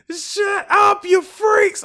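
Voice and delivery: high, loud imitation of a grumpy old man's yell; old man on the bus shouting down the loud passengers, mimicked loud; mock angry shout. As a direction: snap it at the noisy riders like a fed-up old man.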